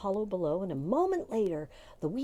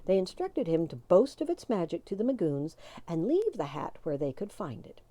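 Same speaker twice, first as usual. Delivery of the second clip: usual speaking voice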